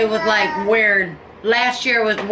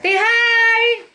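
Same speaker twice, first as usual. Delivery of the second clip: much higher, playful coaxing voice; 11 semitones higher, 3 dB louder than usual. Real, not acted. To the baby for the viewers, high baby talk.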